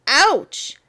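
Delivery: usual speaking voice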